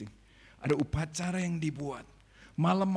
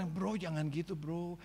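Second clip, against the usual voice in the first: quiet friendly warning; 2 semitones higher, 6 dB quieter than usual. Retold or imitated. A friend quietly warning his buddy. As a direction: pull a friend aside gently.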